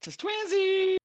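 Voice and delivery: very high, cutesy squeal; squeaky high twinsies. Retold or imitated. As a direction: squeal it like a giddy kid. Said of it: "Oh, her innocence".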